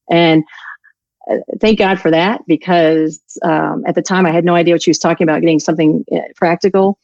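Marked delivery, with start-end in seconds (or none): none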